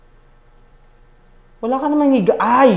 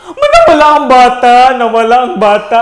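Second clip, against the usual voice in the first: scared cry; 3 semitones higher, 7 dB louder than usual. Real, not acted. Panic after baby vanishes.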